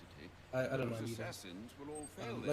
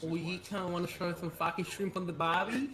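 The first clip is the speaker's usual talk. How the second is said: exaggerated Australian accent; 3 semitones higher than usual. Streamer performing Australian for chat, exaggerated Australian accent. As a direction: play up the Australian accent for laughs. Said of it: "chat wants me to say something in Australian".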